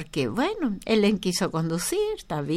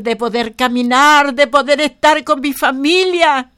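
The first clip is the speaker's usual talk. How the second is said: loud preaching building higher toward the gracias; fervent preaching; 4 semitones higher, 7 dB louder than usual. Acted. Preacher urging the congregation to thank God, building loud preaching. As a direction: lift the whole church into thanks.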